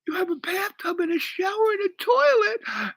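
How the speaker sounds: high-pitched wail imitating a weeping woman; mock weeping. Woman seeing his bathroom, acted weeping. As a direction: sob the list out in a squeaky falsetto. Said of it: "And she's now weeping".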